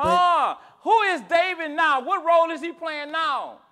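high, loud preacher cadence with falling ends; impassioned preaching. Real, not acted. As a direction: drive each repeated line home to the congregation. Preacher questions the congregation.

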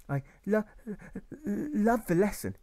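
put-on a shy boy choking on his words; nervous stammer. Boy nervously speaking to his teacher, stammering. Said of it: "with nerves which clearly show".

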